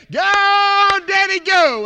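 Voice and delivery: put-on high, loud child's cheer; little girl cheering. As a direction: call out like a daughter cheering Daddy on. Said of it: "that little one that says".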